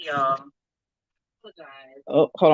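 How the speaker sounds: echoing room sound; big hall echo. Real, not acted. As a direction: voice bouncing in a hall. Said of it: "I'm sorry, I'm echoing".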